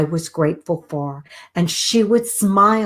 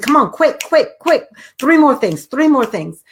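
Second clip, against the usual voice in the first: high, quick, eager prodding; urgent coaxing; 6 semitones higher, 3 dB louder than usual. Retold or imitated. Daughter urgently coaxing her panicked mother, high and eager. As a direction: hurry her along before she thinks.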